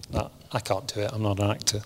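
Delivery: usual speaking voice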